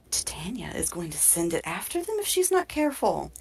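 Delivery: hushed, gasping mock alarm; gasp, then warn in an airy whisper; dramatic gasp. Frog warns that Titania will send it, dramatic hushed alarm. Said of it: "gasps dramatically".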